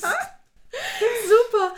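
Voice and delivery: high, loud, laughing; laughing while talking. Real, not acted. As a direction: laugh the word out. Reacts to the posted clip, laughing.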